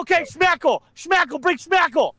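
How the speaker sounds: high eager calls; excited calling. Real, not acted. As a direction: cheer the name out high. Calling for a chat guest.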